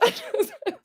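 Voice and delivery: high-pitched giggle; squealing laugh after a cheer; delighted laugh. Real, not acted. Laughs after her own cheer.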